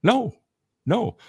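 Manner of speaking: normal talking voice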